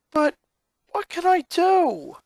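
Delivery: begging for any way out; desperate boy. Acted. Lincoln asks his sister what to do, desperate.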